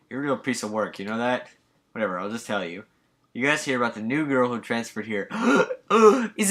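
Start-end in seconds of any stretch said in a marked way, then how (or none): 5.3-6.3 high, loud excited gasp; loud delighted gasp; sharp gasp. Acted. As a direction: gasp at the juicy gossip. Hearing about the new girl.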